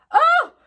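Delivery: very high, loud mock "oh"; mock shriek. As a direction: squeal it like the drunk bride. Imitating the bride's reaction.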